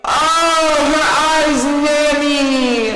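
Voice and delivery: loud, high lovesick wail; mock wail. Reviewer exaggerating the hero's swoon, loud high wail. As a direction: bellow it like a lovesick fool. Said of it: "Her eyes were like, "Oh, Nanny".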